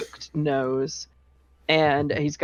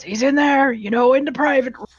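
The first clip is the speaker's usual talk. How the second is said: raised, brighter innkeeper voice; Durnan's voice; 9 semitones higher, 5 dB louder, faster than usual. Acted. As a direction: call it out cheerfully as the barkeep. Durnan points to curtained room.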